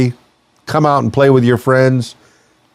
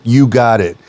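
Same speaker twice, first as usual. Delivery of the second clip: ordinary delivery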